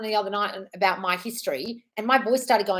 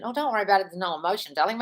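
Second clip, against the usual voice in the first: ordinary talk, nothing marked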